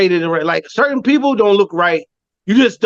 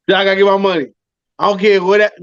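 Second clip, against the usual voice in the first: usual speaking voice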